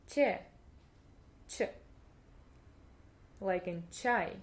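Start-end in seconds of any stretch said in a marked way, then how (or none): none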